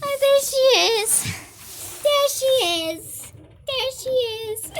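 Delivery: high sing-song pet talk. Real, not acted. Spotting her pet, high cooing pet voice. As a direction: coo to the animal.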